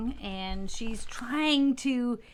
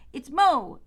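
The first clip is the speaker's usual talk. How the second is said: a loud, higher cry; cried out; 6 semitones higher, 10 dB louder than usual. Acted. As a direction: shout your name from the creek. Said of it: "I cried".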